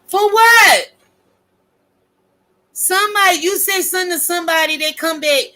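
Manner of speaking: high, loud outraged cry; heated yell. Real, not acted. Ranting at content creators, loud and high. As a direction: throw it out in outrage.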